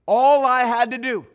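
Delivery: loud and high, laughing at himself; exasperated emphasis. Real, not acted. Preacher to the congregation, loud exasperated emphasis. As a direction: throw the line out big and loud. Punchline of the story.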